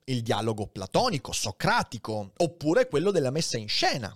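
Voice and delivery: ordinary talk, nothing marked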